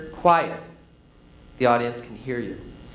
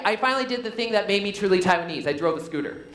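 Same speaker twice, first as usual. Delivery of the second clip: fast, no pause before the punchline; rushed, no pause; faster than usual. Shown as a demonstration. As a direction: rush through without pausing. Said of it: "But if I just say".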